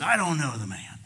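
mock outburst of denial; cursing denial. Preacher as Peter denying Jesus a third time, cursing. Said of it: "he cursed and said".

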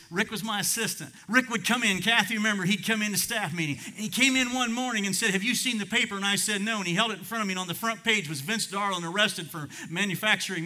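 urgent sermon anecdote; impassioned testimony. Real, not acted. Preacher tells testimony with force.

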